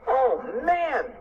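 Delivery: high-pitched, swooping exclamation; amazed cry. A narrator's reading. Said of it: "cried Cheeseball".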